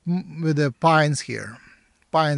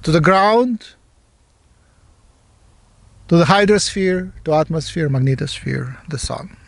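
meditation guide with long pauses between phrases; guided meditation. Real, not acted. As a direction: give each phrase weight, let it fall, then leave silence.

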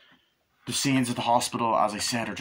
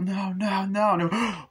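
high, repeated mock cries of horror; panicked cries; 10 semitones higher, much slower than usual. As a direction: plead in rising panic. Horrified cries at stabbing, retold.

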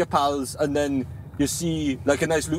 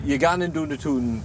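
Geordie accent. Shown as a demonstration. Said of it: "you'd say that".

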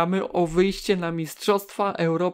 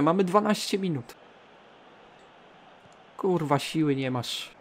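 ordinary talk

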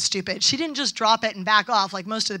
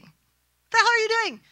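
angry telling-off; 6 semitones higher, 3 dB louder than usual. Retold or imitated. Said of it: "I didn't quite say it like that".

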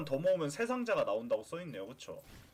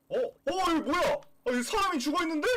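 startled; 8 semitones higher, 5 dB louder than usual. Real, not acted. Sees body in game.

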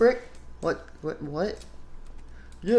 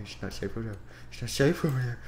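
ordinary talk, nothing marked

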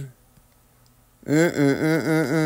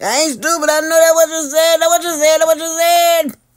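high mocking squeal; 10 semitones higher, 5 dB louder than usual. High mock accusation, laughing.

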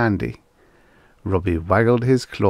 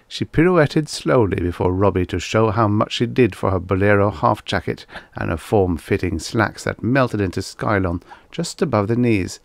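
usual speaking voice

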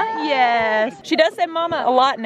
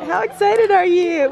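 ordinary talk, nothing marked

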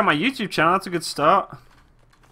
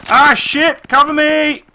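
urgent call for cover, higher; cover me shout; 6 semitones higher, 4 dB louder, much slower than usual. Real, not acted. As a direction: yell for cover under fire. Under fire, calls cover.

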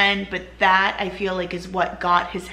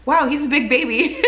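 surprised laughing exclaim, higher and louder; amazed; 7 semitones higher, 5 dB louder than usual. Retold or imitated. New mother recalling first seeing her son, amazed with a laugh. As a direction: marvel at the big baby with a laugh.

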